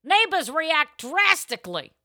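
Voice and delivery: slow, high headline announcement; dramatic headline reading. Acted.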